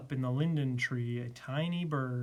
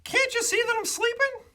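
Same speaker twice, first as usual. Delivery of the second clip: very high, loud indignant bird; high-pitched annoyed bird character; little bird; 6 dB louder, faster than usual. A narrator's reading. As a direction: scold in a tiny squeaky voice. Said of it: "said the bee".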